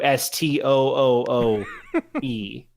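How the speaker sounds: S T O O O E spelled slowly with laughter. Shown as a demonstration. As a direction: spell S T O O O E letter by letter. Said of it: "his name is Stu".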